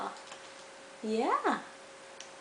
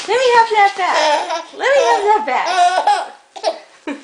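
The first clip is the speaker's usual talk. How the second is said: laughing; 5 semitones higher, 5 dB louder than usual. Acted. Playful tug game.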